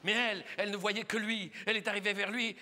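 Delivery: normal talking voice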